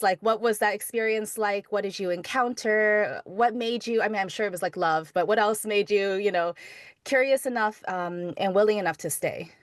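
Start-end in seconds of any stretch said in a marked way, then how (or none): none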